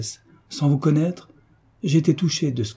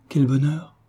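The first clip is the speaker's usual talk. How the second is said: quick, bright exclamation, pitched up; an involuntary burst of delight; lively outburst; 5 semitones higher than usual. A narrator's reading. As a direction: blurt out Quel bonheur with sudden joy. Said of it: "dit vivement Ernestine".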